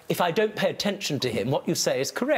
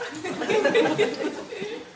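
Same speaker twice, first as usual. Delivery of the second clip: high laugh. Real, not acted. Judge laughs at the goat.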